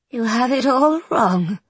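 put-on slow, raspy old woman. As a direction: rasp it out slowly. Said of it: "says a raspy voice".

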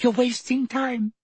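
put-on a high young girl speaking; Hermione's voice. A narrator's reading. Hermione voiced in read story.

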